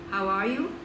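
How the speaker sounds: ordinary delivery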